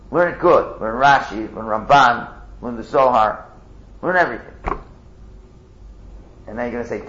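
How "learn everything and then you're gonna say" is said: normal talking voice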